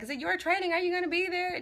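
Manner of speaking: high-pitched imitation of eager questioners; quoted questions in a high voice; imitating everybody. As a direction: mimic their questions way up high. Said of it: "Everybody's like".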